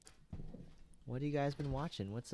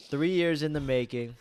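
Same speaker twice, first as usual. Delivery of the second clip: loud, upbeat show opening; podcast host intro; 6 dB louder, slower than usual. Acted. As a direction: hype up the episode like a host. Opens the podcast episode.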